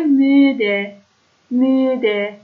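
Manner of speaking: «میده» with a rounded German ü in the first syllable; throaty ü. Shown as a demonstration. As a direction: model «میده» slowly, ü from back in the throat. («میده» با ü از ته حلق)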